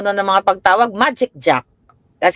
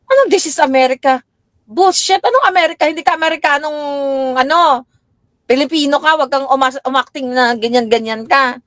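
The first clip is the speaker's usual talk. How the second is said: angry rant; 7 semitones higher than usual. Retold or imitated. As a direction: rant at her, pitch high. Mother rants at daughter.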